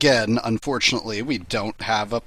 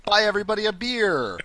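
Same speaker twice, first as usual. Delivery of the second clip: mock game card; 9 semitones higher, faster than usual. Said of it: "it's like".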